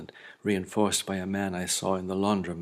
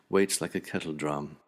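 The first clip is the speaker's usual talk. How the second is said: ordinary delivery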